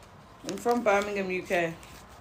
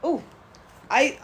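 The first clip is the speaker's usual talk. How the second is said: ordinary talk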